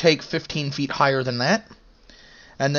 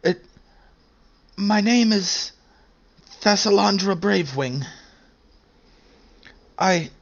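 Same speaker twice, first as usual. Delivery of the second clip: stranded airship pilot; 5 semitones higher than usual. Acted.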